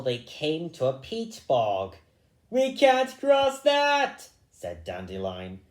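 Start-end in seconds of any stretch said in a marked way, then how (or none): 2.5-4.3 high, loud, slow whiny rabbit. Acted. Dandelion balking at the bog, high and whiny. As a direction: pitch it up and drag it out.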